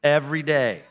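loud, falling every day; punchline shout. Acted. Preacher answers his own riddle to the congregation, loud. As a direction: land the answer hard.

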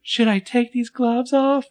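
high, slow, drawn-out teasing question; creepy coy taunt. Retold or imitated. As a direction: coyly dangle it, stretched and loud. Acting out Sarah's taunting line.

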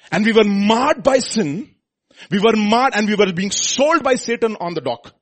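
loud, high, fervent preacher's voice; full-force preaching. Real, not acted. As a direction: proclaim it to the congregation.

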